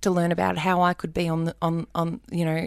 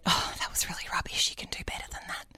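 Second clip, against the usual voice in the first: breathy hushed self-scolding; self-critical whisper; 10 dB quieter, faster than usual. Retold or imitated. Muttering to herself after a rubbish audition. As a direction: whisper a put-down to yourself.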